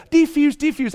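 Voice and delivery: high, singled-out mock soothing cry; mock peacemaker. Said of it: "tearing up the stairs to go".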